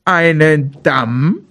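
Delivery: slow, puzzled bear question; bear calling out surprised. A narrator's reading. Bear hearing of the dam from Maral, mildly surprised. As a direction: stretch it out in mild disbelief. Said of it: "rief der Bär".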